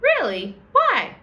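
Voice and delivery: very high, loud squeaky disbelief; a girl astonished at old-fashioned etiquette, very high; incredulous child. Acted. Chloe incredulous.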